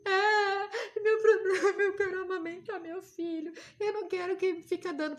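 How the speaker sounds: loud, high crying imitation, easing off; mock wailing. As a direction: burst into a hammed-up wail. Re-enacts her tearful answer to nurse.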